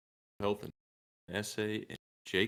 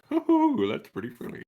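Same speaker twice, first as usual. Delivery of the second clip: high hooting laugh; mock laugh; 3 dB louder than usual. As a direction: hoot it out, amused. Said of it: "sitting at my phone going like".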